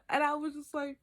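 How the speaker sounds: ordinary talk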